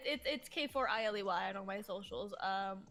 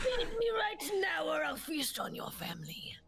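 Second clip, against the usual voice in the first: high, threatening little gremlin; 7 semitones higher, 3 dB louder than usual. Acted. Guest as hungry gremlin threatening a feast, in gremlin voice. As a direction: make a hammed-up gremlin threat, high-pitched. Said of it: "I'll do a gremlin voice".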